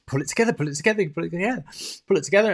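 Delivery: hurried muttered self-coaching; urgent self-talk. Retold or imitated. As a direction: rattle it off, willing yourself composed.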